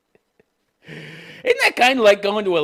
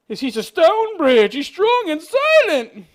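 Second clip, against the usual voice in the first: high, loud, stretched-out swooning; hammed-up high-pitched adoration; mock gushing bride; 14 semitones higher, 7 dB louder, slower than usual. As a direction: read it as an overblown love declaration, slowly. Mockingly reading bride's gushing quote.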